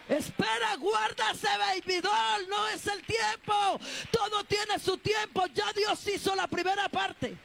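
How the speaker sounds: high, loud shouted preaching; preacher shouting to the congregation; preaching at full force. Acted. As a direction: shout God's timing at the church. Preacher shouting sermon climax.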